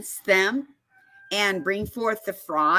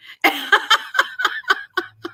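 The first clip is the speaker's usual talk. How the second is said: bursting high-pitched laugh; 4 dB louder than usual. Real, not acted. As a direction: laugh out loud, delighted. Laughs at the judge's ruling.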